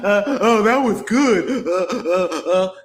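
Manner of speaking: laughing along in mock delight; chuckling mock impression of a guest laughing; mocking laughter. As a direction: cackle through it as if in on the joke.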